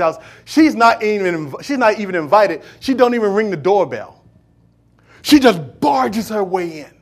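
loud, driving sermon delivery; a pastor pressing the point home, loud preaching. Real, not acted. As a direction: preach it hard to the congregation. Preaching builds to full force.

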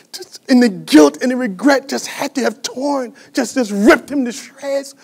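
high, strained, emotional sermon voice; pained, pleading storytelling; anguished preaching. Real, not acted. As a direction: preach his hurt as if near tears. Preaches Peter's grief.

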